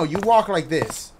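ordinary talk, nothing marked

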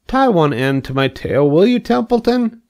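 a lightly voiced hopeful pig; eager young pig. Wilbur asking Templeton to tie his tail, eagerly.

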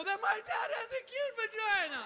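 high, loud comic wail; hammed-up tearful outburst; distraught wail. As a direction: cry it out like a horrified son. Stoned son's distraught punchline.

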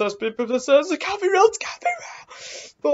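rising horror, voice shooting upward; scared disbelief. Real, not acted. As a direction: panic building to a very high squeal. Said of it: "That scared me more".

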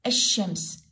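الشمس with a long sh straight after the A; silent lam. Shown as a demonstration. Teacher shows the sheen is heard, lam silent. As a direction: say الشمس as "ash-shams", dropping the L. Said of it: "لا، اللام ما تلفظ".

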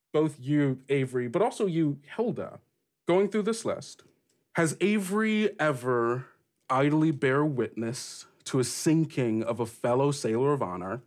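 usual speaking voice

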